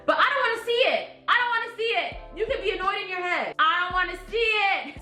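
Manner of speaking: fed up and pleading; exasperated. Real, not acted. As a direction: say it like you've had enough. Venting about the annoyed doctor.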